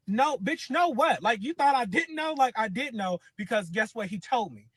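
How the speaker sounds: retold clapback at the cousin's girlfriend on the phone; angry retort. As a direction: hit back at her hard. Telling off the girlfriend.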